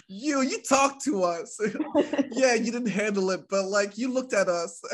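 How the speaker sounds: high, laughing whiny customer voice; mock entitled customer. Host lampoons customers blaming the clerk, high laughing whine.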